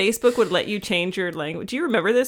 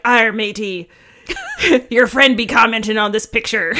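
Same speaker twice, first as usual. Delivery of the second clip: laughing louder pirate speak; pirate accent; 2 semitones higher, 6 dB louder than usual. Acted. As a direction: read it like a pirate. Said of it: "pirate voice".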